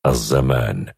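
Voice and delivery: ordinary delivery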